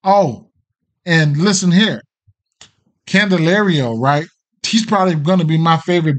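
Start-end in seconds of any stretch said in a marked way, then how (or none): none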